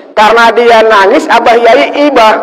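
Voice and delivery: high, loud emotional sermon line; preaching at full force. Acted. Preacher tells the crowd the kiai pitied the boy, loud and high. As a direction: swell with feeling for the crying boy.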